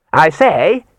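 loud, hailing call. A narrator's reading. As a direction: call out into the dark. Calling out in the dark.

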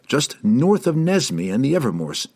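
ordinary delivery, nothing marked

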